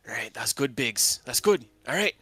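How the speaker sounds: put-on loud, hearty old gnome; gruff mentor voice. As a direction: call out like a cheerful boss. Sid voiced by GM.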